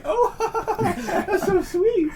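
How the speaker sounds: very high, slow cooing with a laugh; swooning girls. Retold or imitated. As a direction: melt over his name. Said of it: "And they're like".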